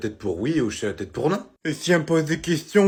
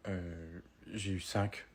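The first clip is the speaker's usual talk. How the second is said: sheepish mumble; 7 semitones lower, 16 dB quieter, much slower than usual. Acted. Kid admits bad grade to dad.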